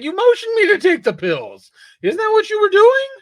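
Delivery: high, loud mock whine; mocking a character. Mocking O'Hara answering Rita Hayworth, high mock whine. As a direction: squeak it out like an outraged dupe.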